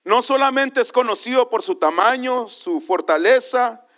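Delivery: high, loud sermon proclamation; forceful preaching. Real, not acted. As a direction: declare the point to the congregation.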